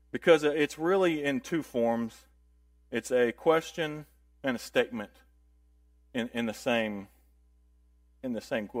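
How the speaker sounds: ordinary delivery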